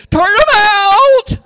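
loud, high, drawn-out yell; a cowboy hollering for the gate to open; yelled command. Retold or imitated. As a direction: bellow it to open the chute. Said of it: "and then you yelled".

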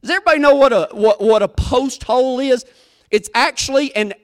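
ordinary delivery

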